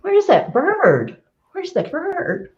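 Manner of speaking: high, searching call, slowing on the second question; craning up to spot a bird in the trees; wondering aloud. Retold or imitated. Coach imitating someone hunting for a singing bird, high and curious. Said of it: "you're like".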